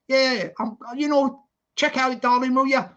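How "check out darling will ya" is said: ordinary talk, nothing marked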